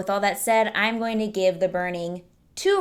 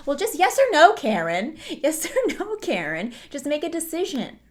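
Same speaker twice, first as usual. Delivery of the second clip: high-pitched impatient counselor imitation; mock exasperation, pitch leaping up and dropping on the name; exasperated mimicry; 8 semitones higher than usual. Camp counselor pressing Karen for an answer, mock exasperated.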